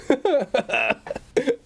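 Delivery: high burst of real laughter; cracking up at a funny comment; laughing. Real, not acted. Reading a funny chat comment.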